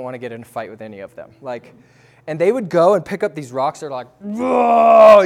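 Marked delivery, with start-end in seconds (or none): 4.2-5.2 sudden high shout of awe, like lifting a boulder; mock shout. Imitating the islanders straining to lift the rocks, shouting. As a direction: belt out an impressed whoa.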